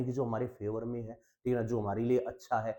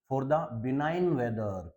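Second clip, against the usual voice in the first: slow dictation; much slower than usual. Shown as a demonstration. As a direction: read "the farmers were grateful" very slowly. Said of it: "teacher dictates example sentence".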